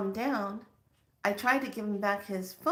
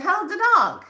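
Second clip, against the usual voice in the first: excited; 11 semitones higher, 12 dB louder than usual. Retold or imitated. Eager follow-up question.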